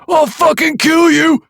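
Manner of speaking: very high loud slow shriek; unhinged shriek. A narrator's reading. Nick charging the killer, unhinged shriek. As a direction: scream with desperate fury. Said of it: "he shrieked with a voice filled with unhinged".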